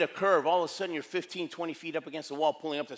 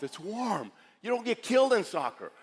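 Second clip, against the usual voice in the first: usual speaking voice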